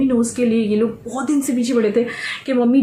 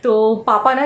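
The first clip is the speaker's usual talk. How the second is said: ordinary delivery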